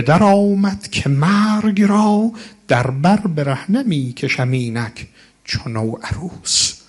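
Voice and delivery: slow, drawn-out recital of the verse; elegy verse, slowly intoned; poem recital. Acted. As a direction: chant each line slowly, savouring it. Recites Persian verse on Abis.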